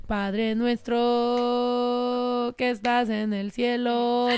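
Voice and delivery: drawn-out, slow Spanish prayer; slow prayer recital. Shown as a demonstration. Reciting the Padre Nuestro for a church album, slow and solemn. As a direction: intone it slowly like at mass. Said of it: "we gotta put an original rap verse in there".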